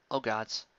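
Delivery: ordinary talk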